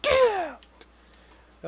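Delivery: very high, stretched-out "get out"; mock banishing cry. Joking friend throwing the others out, high mock cry. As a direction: squeal the dismissal in falsetto.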